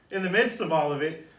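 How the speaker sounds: ordinary talk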